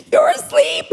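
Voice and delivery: very high squeal; hammed-up high-pitched shriek; freaking out. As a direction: squeak it in disbelieving mock panic. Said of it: "I'm, like, freaking out".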